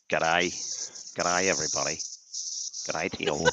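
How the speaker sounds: put-on Australian accent, friendly hello. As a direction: greet them like an Aussie. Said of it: "just for those Australian friends".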